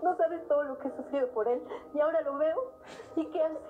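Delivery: tearful. Acted. Mother tearfully tells of son.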